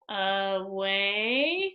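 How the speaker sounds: "away" stretched slowly, rising at the end; sounded out. Shown as a demonstration. Teacher sounding out a word for pupils, "away" stretched slowly. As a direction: draw out both syllables of "away" with a rise. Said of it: "after I sound it out".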